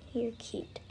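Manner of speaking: soft whisper close to him; whisper to horse. Real, not acted.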